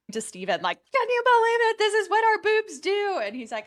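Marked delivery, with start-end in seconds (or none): 0.9-3.2 high, loud shriek of disbelief; high-pitched mock scream, held loud; retold excited screaming. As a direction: screech it, reenacting your amazed outburst. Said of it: "was, like, screaming".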